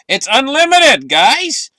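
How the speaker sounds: excited exclaim. Real, not acted. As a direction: sell it like huge news. Hyping the coming rally.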